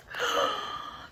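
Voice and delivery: sharp high airy gasp of surprise; breathless storyteller gasp. Acted. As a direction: gasp as if amazed by the page. Surprise at next page.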